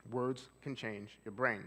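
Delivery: slow, deliberate statement; unhurried calm delivery. Shown as a demonstration. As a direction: let each word land slowly. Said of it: "I'm slowing my speech down".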